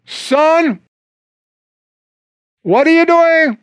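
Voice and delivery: a mother catching her son red-handed, voiced in play; mother calling out to her son at the cookie jar; mom catching her son.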